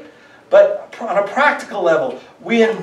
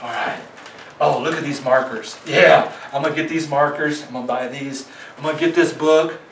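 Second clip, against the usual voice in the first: lower, softer, mock eager self-talk; religious flesh voice; 6 semitones lower, 3 dB quieter than usual. Pastor mimicking his religious flesh to the congregation, low mock eagerness. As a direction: play the eager legalist piling up books. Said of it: "my religious flesh would rise up and say".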